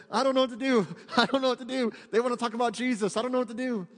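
high, loud flustered panic, half laughing; mock panic. Believer stumped when Jesus comes up, high flustered panic. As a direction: play a believer caught off guard, flustered. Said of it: "you're going".

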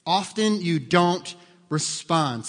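every word laid down slowly; slow and deliberate for emphasis; slow, weighted delivery. Shown as a demonstration. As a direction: spell it out slowly so it sticks. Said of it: "Lock it up".

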